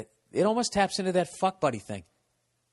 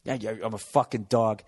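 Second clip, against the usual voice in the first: sarcastic; 7 semitones lower than usual. Retold or imitated. Acted retort to the woman.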